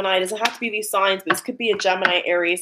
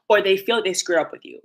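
ordinary talk, nothing marked

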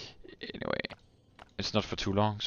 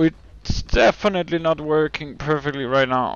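usual speaking voice

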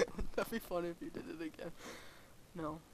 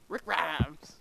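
imitating a TV character saying his own name; Rick Grimes; 2 semitones lower than usual. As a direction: announce the name in a mock character voice. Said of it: "'cause that's how he says it".

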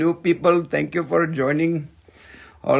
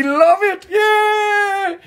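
mock cheer; much slower than usual. Mock cheer about world ending.

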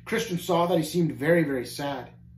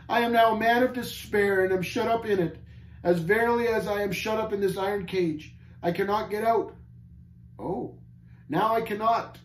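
high, despairing lament; bitter cry; 6 semitones higher than usual. A narrator's reading. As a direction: cry out as one hopelessly shut in. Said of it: "he cried bitterly".

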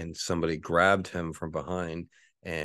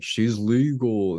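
slow, drawn-out sleazy shock-jock voice, higher and louder; leering radio host; 5 semitones higher, 6 dB louder, much slower than usual. Retold or imitated. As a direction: ham up a crude radio DJ gloating. Radio hosts leering at [name]'s birthday.

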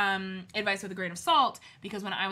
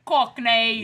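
loud, drawn-out mock Cockney; terrible Cockney accent; 11 dB louder than usual. Shown as a demonstration. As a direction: stretch it in a hammy Cockney. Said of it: "I can only do terrible".

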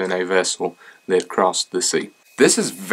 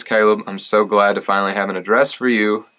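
usual speaking voice

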